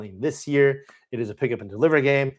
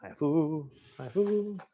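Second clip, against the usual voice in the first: much quieter repeats, like a fading echo; soft echo; 3 semitones higher, 8 dB quieter, slower than usual. Pretend. Host echoing the game title, playfully soft. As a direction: echo the title softly, rising in pitch. Echoes his own shouted title.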